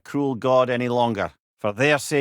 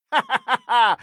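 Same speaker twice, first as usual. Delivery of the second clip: a short high laugh, delighted; high amused laugh; 8 semitones higher, 3 dB louder than usual. Acted. Laugh before mocking word.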